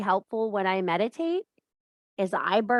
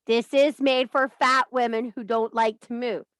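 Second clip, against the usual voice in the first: normal talking voice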